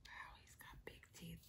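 breathy awed whisper; hushed whisper of wonder. Acted. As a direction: whisper it like a secret. Awed aside about teeth.

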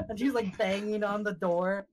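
ordinary delivery

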